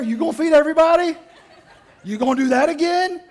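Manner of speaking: loud, high sarcastic tirade; exasperated scolding. Acted. Martha scolding Jesus for bringing twelve men, loud and exasperated. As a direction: lay into the guest who sprang this on you.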